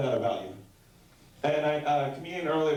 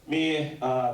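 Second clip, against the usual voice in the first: ordinary talk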